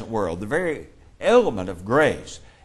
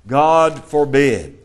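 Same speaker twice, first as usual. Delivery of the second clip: slow, heavy God forbid, louder; emphatic quote; 6 dB louder, much slower than usual. Shown as a demonstration. Teacher reading Paul's answer to the class, slow and emphatic. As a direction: lean hard on God forbid, slowly.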